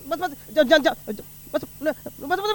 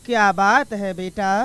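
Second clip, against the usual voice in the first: lightly voiced mother, a bit lower and softer; mother answering her son, a touch lower; mother's voice; 6 semitones lower, 5 dB quieter, slower than usual. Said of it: "ओ री अम्मा".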